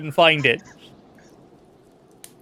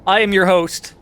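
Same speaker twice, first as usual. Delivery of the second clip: loud, high showman opener; big announcer welcome; hyped host intro; 4 semitones higher, 6 dB louder than usual. Acted. Over-the-top podcast host intro.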